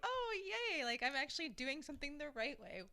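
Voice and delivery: high-pitched mock cheer, re-enacting happy surprise; playful squeal of amazed self-approval; delighted self-congratulation. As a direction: act out giddy pride, pitch soaring on the first words. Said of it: "I was just really happy".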